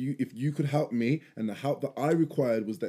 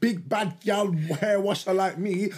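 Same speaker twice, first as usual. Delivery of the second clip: higher, slow, swaggering mock voice of his partner; mock proud hair pro; 6 semitones higher, slower than usual. Mocking his partner's pride in doing hair, higher and swaggering. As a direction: puff up like the big bad hair washer. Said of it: "I know in her head".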